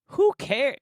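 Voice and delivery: high, loud burst of exasperation; exasperated outburst. Real, not acted. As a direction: blurt it out high and loud, then cut off. Cuts off own exasperated outburst.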